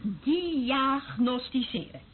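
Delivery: slow, savoured word. Acted.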